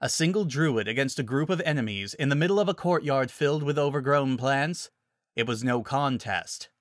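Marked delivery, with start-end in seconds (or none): none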